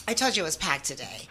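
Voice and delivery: normal talking voice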